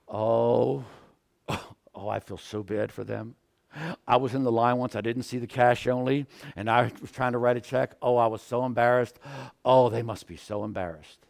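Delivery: low, soft, gasping sympathy; sympathetic bystander. Acted. Next person in line pitying the check writer, sympathetic. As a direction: feel embarrassed on their behalf, voice dropped and gentle. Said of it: "The person right behind me is probably saying".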